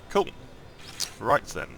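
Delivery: usual speaking voice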